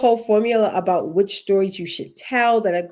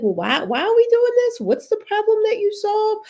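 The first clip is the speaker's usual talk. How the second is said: much higher, questioning interviewer voice; puzzled interviewer; 14 semitones higher, 4 dB louder than usual. Pretend. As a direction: ask it as a baffled interviewer. Voicing a confused interviewer.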